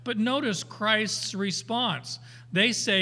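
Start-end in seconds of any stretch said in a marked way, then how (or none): none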